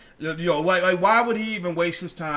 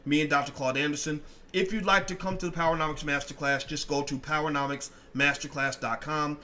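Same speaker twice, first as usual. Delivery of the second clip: ordinary talk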